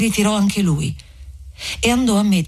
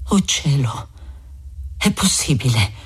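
breathy, lower whisper of wonder; stunned disbelief; 5 semitones lower than usual. A narrator's reading. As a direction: whisper it low, hardly daring to believe. Stunned hero recognises a voice.

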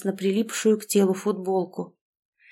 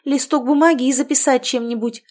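higher, louder urgent call; urgent demand; 5 semitones higher, 5 dB louder than usual. A narrator's reading. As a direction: demand it at once before it slips away.

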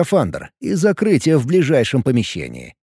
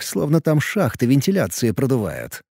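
usual speaking voice

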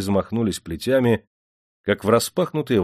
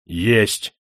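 short, firm reply. A narrator's reading. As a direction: answer without wavering. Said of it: "твёрдо ответил Мастерко".

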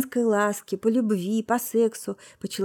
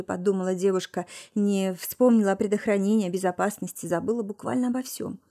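plain reading voice

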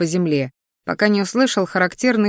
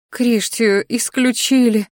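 higher, slow, sighed lament; bitter sigh; 5 semitones higher, slower than usual. A narrator's reading. Mother telling the father the news, bitter sigh. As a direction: sigh it out in grief. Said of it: "горько вздохнула матушка".

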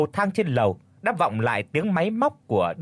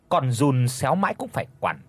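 plain reading voice

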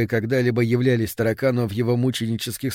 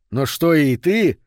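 slow, raised question; appalled disbelief; 4 semitones higher, 5 dB louder, slower than usual. A narrator's reading. Man finding the mother crazy too, appalled disbelief. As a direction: drag it out in disgusted amazement.

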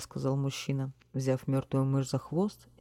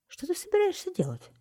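high frightened exclamation; frightened cry. A narrator's reading. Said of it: "испуганно воскликнула вдова". (испуганный высокий возглас)

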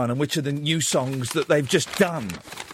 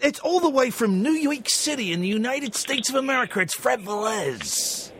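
hammed-up American announcer intro, slowing on the name; a light American accent, like a big-stage host; mock American accent; 5 semitones higher than usual. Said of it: "if I did an American accent".

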